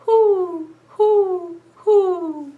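high hooting owl. Retold or imitated. Librarian hooting like an owl for the kids. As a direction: hoot like an owl. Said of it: "A snowy owl is what I found".

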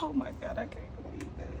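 very soft, falling into a whisper; hushed dismay. Acted. Wife taking in the pregnancy news, whispered dismay. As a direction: let the disbelief escape under the breath.

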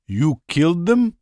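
a high, avid listener's question; a touch of a greedy listener; eager question. Acted. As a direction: ask hungry for gory details. Eager listener asks avidly.

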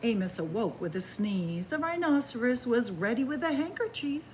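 gentle, lively bedtime storytelling; cozy storybook narration; reading to children. A narrator's reading. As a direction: read it like a picture book to little ones. Reads a picture book aloud.

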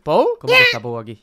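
ordinary talk

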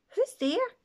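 high calling out into the dark; called out. A narrator's reading. Ben calling out to a voice in the shadows, high nervous call. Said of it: "Ben called out".

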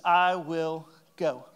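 a lightly voiced Rebecca, very slow and deliberate; Rebecca's answer. Rebecca agreeing to go with the servant, slow and deliberate.

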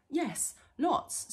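high, hasty hobbit reply; nervous blurt. Acted. Caught hobbit answering trolls too quickly, nervous.

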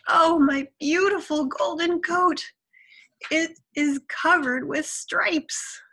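high, loud wail of dismay; storybook scream. A narrator's reading. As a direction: cry out in horror as a vain tiger. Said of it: "Tiger screamed".

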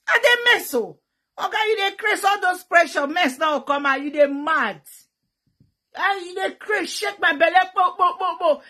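loud, high mock protest; high-pitched hammed-up complaint; acted outburst. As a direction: exclaim it in comic outrage. Acts out shouting mid-act at partner.

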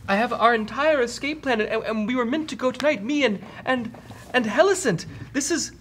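high, hesitant frail runt voice; Snoo Snoo. Acted. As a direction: explain desperately in falsetto, stumbling over words. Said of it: "a pretty frail looking orc".

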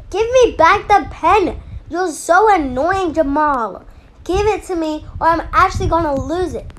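angry yelling. Acted. Girl yelling at Jamal to give back her pen, angry. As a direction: yell it, fed up and demanding.

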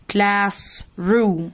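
"Classroom" slowly as class, pause, room; split in halves. Shown as a demonstration. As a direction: separate the two halves of "Classroom". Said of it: "هنقسمها نصين". ("Classroom" على نصين بينهم وقفة)

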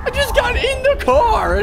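high-pitched outraged yell; shrieking protest. Real, not acted. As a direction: shriek it in disbelief. Repeats protest, much higher.

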